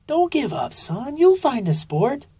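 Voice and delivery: high, gentle mom voice; high-pitched mom encouraging her son; mother sloth voice. A narrator's reading.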